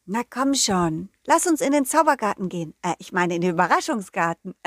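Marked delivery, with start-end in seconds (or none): none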